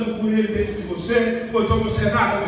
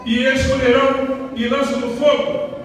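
preaching scripture; 5 semitones higher, 6 dB louder than usual. Acted. Scripture declaimed.

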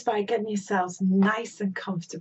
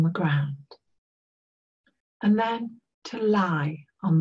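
guided meditation. Real, not acted. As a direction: guide listeners gently into an imagined place.

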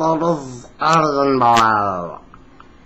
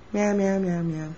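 high meows fading softer; kitten meows; 6 semitones higher, 8 dB quieter, slower than usual. Pretend. Boy meowing as a kitten, high and fading. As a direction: mew on and on, dying away. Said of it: "cute little kitten".

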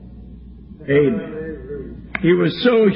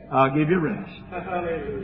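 gentle invitation; 3 semitones lower, 7 dB quieter, much slower than usual. Acted. Quoting Jesus's gentle invitation.

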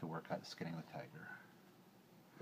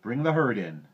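loud, raised command; strong herder's call; 5 semitones higher, 11 dB louder than usual. A narrator's reading. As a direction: shout the order across a distance. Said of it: "he cried".

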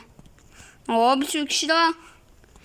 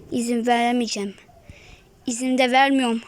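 ordinary delivery

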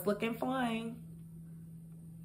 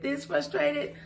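mock frustration; 5 semitones higher, 4 dB quieter, slower than usual. Shown as a demonstration. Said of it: "how frustrated have I been".

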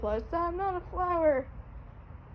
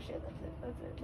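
whispering; 12 dB quieter than usual. Real, not acted. Tense moment, excited whisper.

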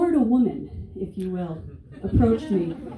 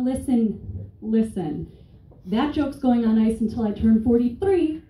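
normal talking voice

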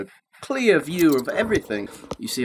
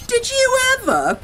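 high amazed exclamation; astonished grandma. A narrator's reading. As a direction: gasp it out in disbelief. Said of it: "Grandma gasped".

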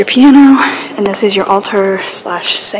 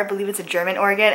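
ordinary delivery

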